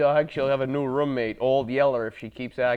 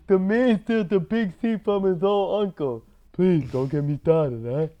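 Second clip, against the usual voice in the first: mobster voice; 4 semitones higher than usual. Pretend. Mobster quotes continue.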